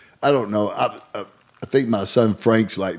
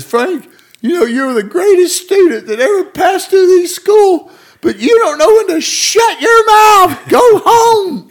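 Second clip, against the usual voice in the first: very high, loud wailing scold through laughter; tearful teacher sending him home; mock crying teacher; 10 dB louder than usual. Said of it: "So she starts crying".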